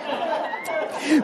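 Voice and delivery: breathless unvoiced laugh; real laughter. Real, not acted. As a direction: let a soft wheezy laugh out. Laughing at own joke.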